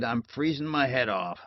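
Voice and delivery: ordinary delivery, nothing marked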